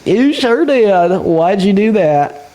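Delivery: slow, high mock Southern drawl; mock Southern accent. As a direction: echo her in an exaggerated Southern accent. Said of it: "Oh, my country accent ain't that bad".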